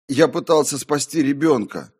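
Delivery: loud, high cry of self-defence; anguished protest. A narrator's reading. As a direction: plead your case at full voice. Protesting the voice's accusation.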